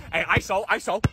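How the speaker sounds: ordinary talk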